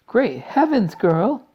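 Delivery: high, loud, horrified mother; screech. A narrator's reading. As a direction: exclaim in shrieking alarm. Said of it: "screeched Missus Beauregard".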